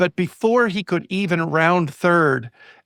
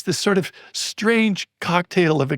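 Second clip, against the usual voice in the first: usual speaking voice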